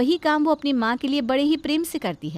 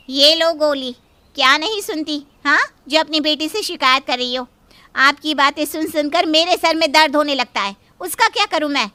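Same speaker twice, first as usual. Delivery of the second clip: louder, sharp, fed-up scolding; irritated scolding; 4 semitones higher, 6 dB louder than usual. Acted. Sister-in-law scolding the complaining mother, loud and fed up. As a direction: snap at the old woman.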